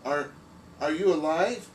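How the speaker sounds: can't believe it's alive; astonished question. Acted. Shocked the drawing is alive.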